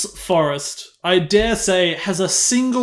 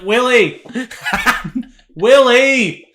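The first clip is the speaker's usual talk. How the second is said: calling out; 4 semitones higher, 4 dB louder, much slower than usual. Retold or imitated. Calling out for her brother.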